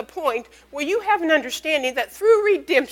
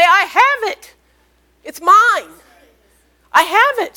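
loud declaration; 6 semitones higher, 8 dB louder than usual. Acted. Preacher proclaims faith confession loudly.

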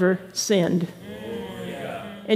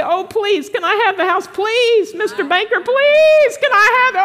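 high, rising begging voice, louder at the end; pleading beggar; 9 semitones higher, 4 dB louder than usual. Retold or imitated. Preacher mocks a believer begging the banker, high pleading. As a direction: beg pitifully like a child. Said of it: "are you gonna say".

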